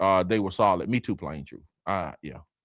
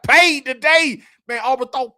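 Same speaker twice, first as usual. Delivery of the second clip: loud, much higher hype call; excited shout; 9 dB louder than usual. Real, not acted. Host celebrating a viewer's fifty-dollar super chat, excited shout. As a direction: celebrate the donation like a hype man.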